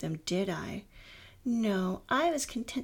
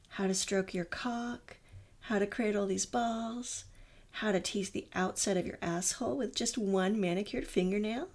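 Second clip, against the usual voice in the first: normal talking voice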